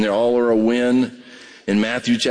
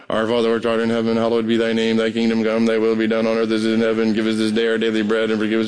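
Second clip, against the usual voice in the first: fast, lower mumble, rushing through memorized words; rattled-off rote prayer; 5 semitones lower, faster than usual. Shown as a demonstration. Pastor mimicking a churchgoer's rote recital, rushed and fast. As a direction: blow through it without meaning it. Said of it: "You don't even know what you're saying".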